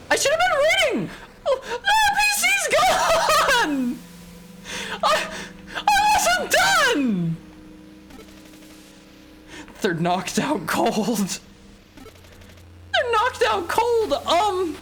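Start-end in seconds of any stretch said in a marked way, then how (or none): 0.1-1.1 very high, loud lament; hammed-up squealed regret; mock wail. Mock wail over game disaster. 1.5-7.4 hysterical high-pitched fake sobbing; hammed-up tearful shriek; mock crying scream. Mock crying over loss in game. 12.9-14.8 loud, very high falsetto; hammed-up high wailing denial; high distressed squeal.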